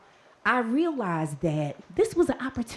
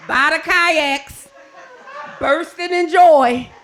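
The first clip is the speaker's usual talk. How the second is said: laughing; 7 semitones higher, 6 dB louder, slower than usual. Real, not acted. Describing [name] laughing.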